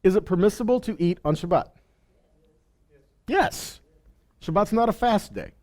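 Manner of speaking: normal talking voice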